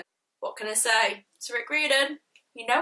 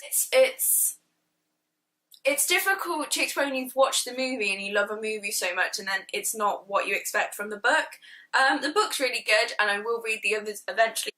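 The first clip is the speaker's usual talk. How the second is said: usual speaking voice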